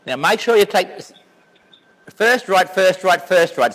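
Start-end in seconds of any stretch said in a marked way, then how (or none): 2.1-3.7 FIRST right hammered home; stressing first right. Shown as a demonstration. To his friend on the phone, stressing first right. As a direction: drill "first right" into the caller. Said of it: "Three first rights".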